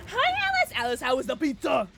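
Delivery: loud, high squeal of hello; thrilled, loud welcome; excited greeting. Real, not acted. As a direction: greet a friend with a delighted burst, starting on a gasp.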